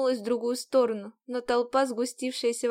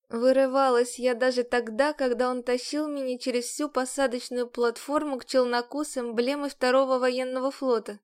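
ordinary delivery

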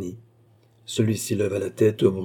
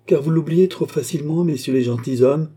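réprimande; 6 semitones higher, 4 dB louder than usual. Acted. Reine réprimande les gentilshommes.

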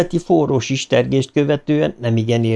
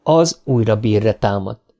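plain reading voice

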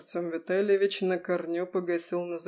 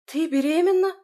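high, loud astonished gasp; gasping shock; 11 semitones higher, 8 dB louder than usual. Acted. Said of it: "ахнула Людмила".

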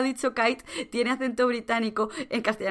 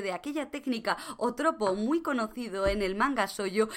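ordinary delivery

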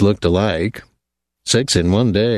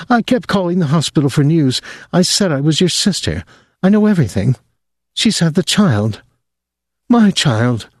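put-on higher, intense voice of a fixated young woman; obsessive young woman; 8 semitones higher than usual. A narrator's reading. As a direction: she clings to him, urgent and possessive. Virginia's obsessed pleading.

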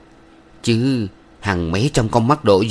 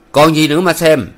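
loud, higher shout at the crowd; barking shout; 8 semitones higher, 7 dB louder than usual. A narrator's reading. Lũ Tuần shouting at the gawking women and children, loud angry bark. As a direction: yell at them to clear off. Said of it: "Lũ Tuần quát".

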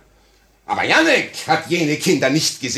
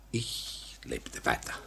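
hollow whisper; 13 semitones lower, 15 dB quieter, slower than usual. Acted. Recalling comrade's suicide in camp.

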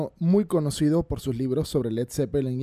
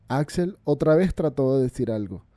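normal talking voice

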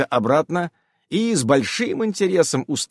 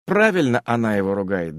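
usual speaking voice

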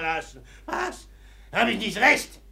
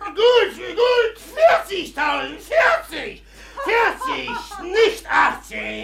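higher, louder, overexcited outbidding; excited bidding; 8 semitones higher, 3 dB louder than usual. Acted. Ragoschin raises his bid for Nastasia, a high excited shout. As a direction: shout the offer up wildly.

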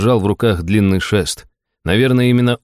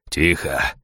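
soft, lower hissed warning; hissed hush; 7 semitones lower, 5 dB quieter than usual. A narrator's reading. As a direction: hiss it sharply under breath. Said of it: "прошипел второй".

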